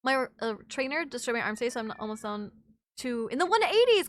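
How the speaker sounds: ordinary delivery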